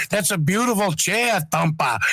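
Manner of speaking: put-on New England accent. Caller fondly quotes the co-host to the hosts, New England accent. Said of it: "I just love the accent".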